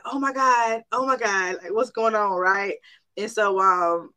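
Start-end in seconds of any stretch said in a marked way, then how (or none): 0.0-2.3 higher-pitched, alarmed appeal; alarmed but contained; mild retold panic. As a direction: recall a rising worry, pleading upward. Retold panicked cry to God.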